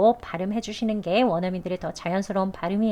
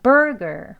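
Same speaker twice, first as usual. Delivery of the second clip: "burger" with loud BUR, weak "ger"; stress on first syllable; 3 semitones higher, 5 dB louder than usual. Shown as a demonstration. Teacher drilling two syllables, "burger" strongly front-stressed. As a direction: punch BUR in "burger", relax the ending. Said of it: "burr 할 때 강세 줍니다".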